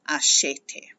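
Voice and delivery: acheter said alone with a clear middle eh; pronounced eh. Shown as a demonstration. Teacher repeating the future stem, acheter with sounded eh. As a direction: sound out acheter as ah-SHEH-tay. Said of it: "now you're actually going to pronounce that eh".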